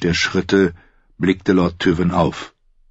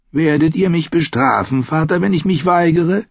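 higher voiced bitter son, probing his father; a higher, defiant son testing his father; Tyrion's voice; 10 semitones higher than usual. Son asking his father about punishment, higher defiant voice.

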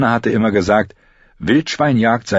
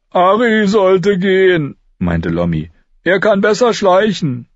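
put-on high, louder, slow boy's voice; Lommi's suggestion; 13 semitones higher, 5 dB louder than usual. A narrator's reading. Lommi argues that Ari should go, pushy. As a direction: push Ari forward.

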